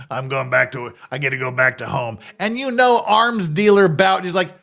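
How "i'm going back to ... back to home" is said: mocking impression of her voice. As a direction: lower put-on voice, a mock of Griner. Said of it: "That's literally what she sounds like".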